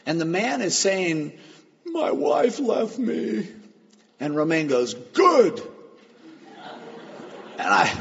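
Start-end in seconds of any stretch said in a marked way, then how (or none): 1.8-3.5 high, drawn-out tearful wail; mock-weep it, high and very slow; imitated crying. Distraught man to the pastor counseling him, imitated crying. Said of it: "who's just crying uncontrollably". 4.9-5.6 loud, high, abrupt retort; blunt exclamation. Retold or imitated. Pastor answering the crying husband, blunt exclamation.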